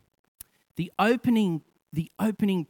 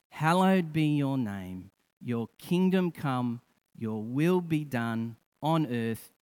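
congregational prayer; 4 semitones lower than usual. Acted. Preacher leading the congregation in the Lord's Prayer, measured praying. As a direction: lead them through it phrase by phrase.

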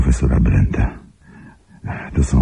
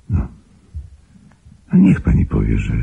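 ordinary delivery

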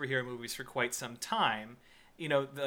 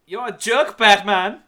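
put-on loud, hammy German accent shout; 6 semitones higher, 11 dB louder than usual. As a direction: bark it in a mock German accent. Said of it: "like, a German accent?".

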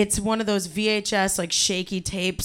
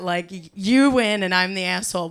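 ordinary talk

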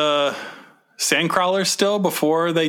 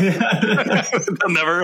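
laughing; 4 semitones higher, much slower than usual. Real, not acted. Joking, laughing mid-line.

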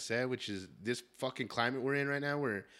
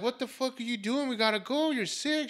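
high-pitched retold exasperation; frantic, scolding son; exasperated outburst; 12 semitones higher than usual. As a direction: argue in a high, incredulous pitch. Retold argument with sick mom.

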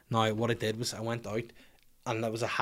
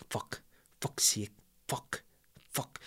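muttered frustration; 7 dB quieter, slower than usual. Retold or imitated. Struggling with chopsticks, muttering.

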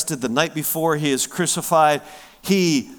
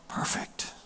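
a breathy hushed whisper on perfect; 12 dB quieter than usual. Real, not acted. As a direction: lean in and whisper the promise. Intimate sermon moment.

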